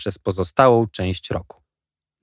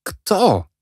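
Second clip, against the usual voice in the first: put-on eager boys; 11 semitones higher than usual. A narrator's reading. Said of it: "powiedzieliśmy wszyscy naraz".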